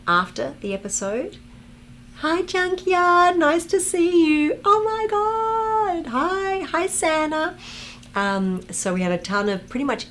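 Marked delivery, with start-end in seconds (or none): 2.2-7.6 very high, slow squealed hello; high excited greeting. Real, not acted. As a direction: squeal a welcome to the fans. Greeting chat names in a high voice.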